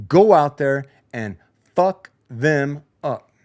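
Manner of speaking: slow, word-by-word pep talk order; rallying command. Acted. Player rousing teammates in the locker room, slow rallying command. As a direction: space out each word to rally the team.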